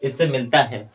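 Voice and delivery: ordinary talk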